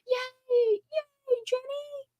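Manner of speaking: tiny, very high squealed yay; delighted cheer. Real, not acted. Streamer cheering Jenny's good news, delighted high squeal.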